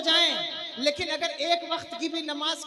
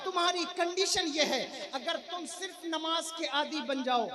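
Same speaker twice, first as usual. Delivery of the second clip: ordinary talk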